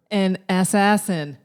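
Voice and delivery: loud, slow punch line; coming in hot. Real, not acted. Host answering her own riddle, coming in hot. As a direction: hit the punch line too hard. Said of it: "I came in a little hot".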